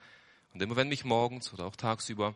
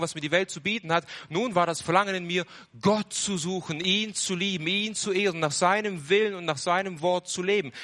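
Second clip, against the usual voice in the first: higher, louder, exultant testimony; fervent preaching; 6 semitones higher, 4 dB louder than usual. Acted. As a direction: lift it in rising joy. Joyful turn to God.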